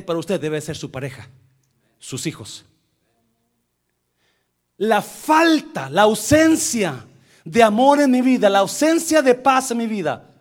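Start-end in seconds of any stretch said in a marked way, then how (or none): 4.8-10.3 raised, loud sermon voice; forceful, higher-pitched pulpit delivery; emphatic preaching. Real, not acted. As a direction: drive each phrase home to the congregation.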